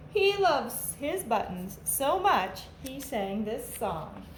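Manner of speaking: put-on lively storybook read-aloud for kids; animated picture-book narration for a class; reading to children. A narrator's reading. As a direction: read it like a teacher at story time, bouncy and clear.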